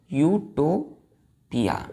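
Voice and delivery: यूटोपिया stretched slowly as yoo-to-pi-ya, falling at the end; slow pronunciation. Shown as a demonstration. Teacher to class, modelling यूटोपिया slowly before spelling it. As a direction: drag out यूटोपिया for students to repeat. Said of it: "U T O P I A".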